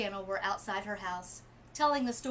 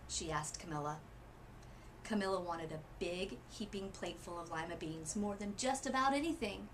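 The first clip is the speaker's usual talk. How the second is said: ordinary delivery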